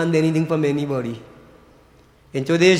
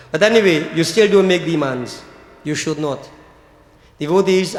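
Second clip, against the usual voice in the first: normal talking voice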